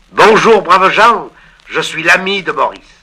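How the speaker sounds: high, loud hearty greeting; calling out loudly. Acted. Letter writer's friend greeting the old couple, calling out loud. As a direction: call it out across the house. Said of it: "tu crieras bien fort".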